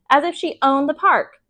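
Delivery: ordinary delivery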